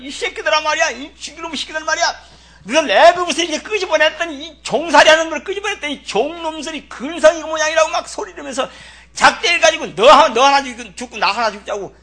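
high, loud enraged rant at the people; furious yelling. Pretend. Preacher playing an enraged Moses, shouting at the people. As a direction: scream at the stubborn crowd. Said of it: "나 같으면 속이 터져가지고".

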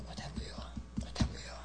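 breathy repeated whisper. Real, not acted. Preacher whispering the point to the congregation. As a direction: let it fade to a whisper. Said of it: "whispered echo".

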